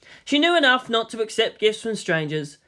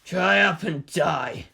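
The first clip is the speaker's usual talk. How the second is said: scornful; slower than usual. A narrator's reading. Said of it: "Kaji snorted scornfully".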